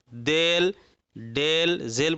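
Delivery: देल, डेल, जेल with long "ay" for "aa"; majhul vowel. Shown as a demonstration. Teacher shows the majhul mistake, देल, डेल, जेल with "e". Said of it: "पढ़ेंगे तो ये मजहूल हो जाएगा।".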